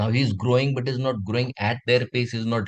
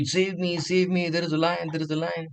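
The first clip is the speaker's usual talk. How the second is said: higher-pitched, repeated cries of alarm; fearful pleading; 6 semitones higher than usual. Acted. Cub calling for help as the lion nears, acted fear. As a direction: act out a scared cub, pitch raised. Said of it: "he's so fearful".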